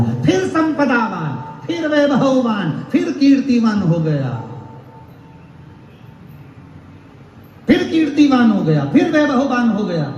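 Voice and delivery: preaching climax. A narrator's reading. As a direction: lift each word to a peak. Climax of story, drawn out.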